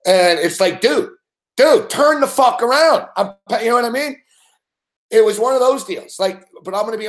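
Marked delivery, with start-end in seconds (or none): 0.8-3.1 higher, louder mock shout to a teammate; mock call-out. Hockey linemate yelling at his partner, mock shout. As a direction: bark it across the ice.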